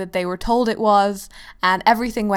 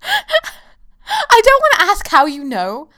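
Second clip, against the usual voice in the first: laughing; 11 semitones higher, 7 dB louder, slower than usual. Real, not acted. Cracking up at a joke.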